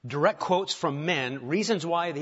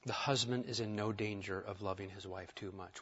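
the line "The husband is in no danger" said softer and lower, letting it sink in; soft, slow repetition; 6 semitones lower, 9 dB quieter than usual. Shown as a demonstration. Pastor repeating the line to husbands, quietly. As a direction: quietly repeat "too much" for weight. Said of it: "Here's the ouch moment".

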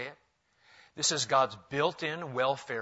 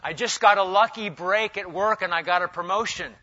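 louder, clearer repeat for a hard-of-hearing pastor; 5 semitones higher, 7 dB louder than usual. Retold or imitated. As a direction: say it again, louder, as if he didn't hear. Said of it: "I'll always say".

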